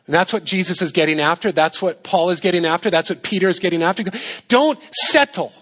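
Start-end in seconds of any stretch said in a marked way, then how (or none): 4.5-5.5 "Don't settle" jumped up high, stretched and sung out; a playful high squeal on "Don't settle"; goofy high-pitched preacher voice; odd high "Don't settle". Real, not acted. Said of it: "I don't know why I'm saying it like that".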